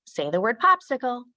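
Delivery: teacher to class. Shown as a demonstration. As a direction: cue young pupils to repeat a word. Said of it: "We could also segment a word".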